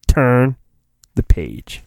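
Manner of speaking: normal talking voice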